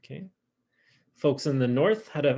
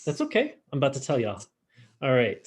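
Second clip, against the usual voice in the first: normal talking voice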